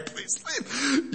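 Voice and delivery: very high, small pleading voice; hammed-up high-pitched plea; squeaky mock plea. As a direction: beg them comically, way up high. Mock plea to spoilers of Christmas.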